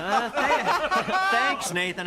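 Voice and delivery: laughing. Real, not acted. Laughs while answering the tease.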